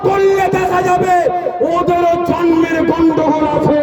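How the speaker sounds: chanting crowd-rousing sermon shout; fiery preaching. Acted. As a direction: rouse the crowd from the stage. Fiery sermon against insulters.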